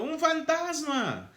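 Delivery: high, slow terrified cry of ghost; high frightened "¡Un fantasma!". Acted. Disciples cry out in fear.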